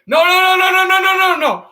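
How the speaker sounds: rapid high, loud string of refusals; frantic protest. Real, not acted. Streamer reacting to a sudden event, frantic high protest. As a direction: burst out high and fast, dropping at the end.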